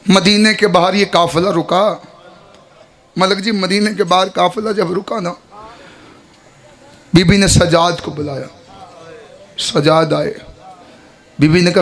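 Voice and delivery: ordinary talk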